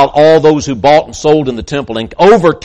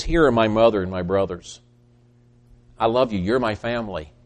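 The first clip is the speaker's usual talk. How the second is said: tender; 4 semitones lower, 7 dB quieter than usual. Acted. Jesus speaking tenderly to disciples.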